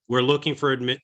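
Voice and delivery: ordinary delivery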